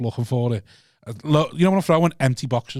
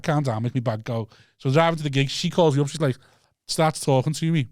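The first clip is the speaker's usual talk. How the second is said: ordinary talk, nothing marked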